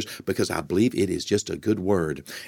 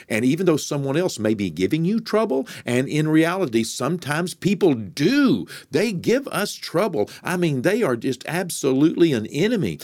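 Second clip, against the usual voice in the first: fervent preaching; 7 semitones higher than usual. Acted. Preacher warning listeners their problem is the devil, fervent. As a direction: preach it, rising with conviction.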